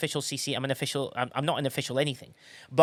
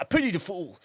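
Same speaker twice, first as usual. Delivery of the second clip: lightly voiced tough-guy catchphrase; loosely imitated catchphrase voice; Mister T; 4 semitones higher than usual. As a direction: toss off a hint of an impression. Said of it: "That's Mister T".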